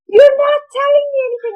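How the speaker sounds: high, loud, fast mock scream. Aunt screaming at the niece, high, loud and fast. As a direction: shriek in frustration.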